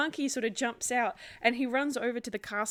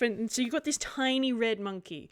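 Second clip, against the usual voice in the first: ordinary delivery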